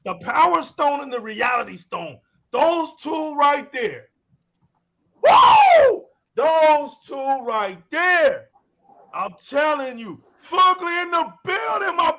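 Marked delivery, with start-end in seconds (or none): 5.2-6.0 excited whoop. Real, not acted. Hype cheer on stream.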